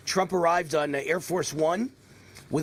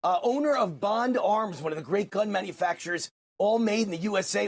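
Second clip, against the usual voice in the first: higher-pitched, emphatic radio introduction; show-host intro; 5 semitones higher than usual. Real, not acted. Host introducing gun maker Gordon, show-host intro. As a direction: introduce the sponsor with punchy, upbeat projection.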